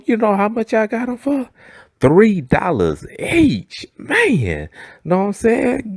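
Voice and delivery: high, slow bragging coworker; mock proud seller, drawn out.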